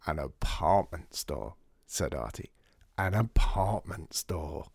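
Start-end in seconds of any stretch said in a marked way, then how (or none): 0.1-1.6 slow, savouring. Acted. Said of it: "Artie savours the phrase". 3.0-4.7 slow, lower, relishing each word; slower savouring. Acted. Artie savouring Lydia's pun, slow and pleased. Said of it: "Artie repeats it, slower, delighted".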